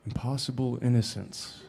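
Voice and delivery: softly mulling it over, retold; musing. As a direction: turn the phrase over quietly to yourself. Mulling over the director's phrase.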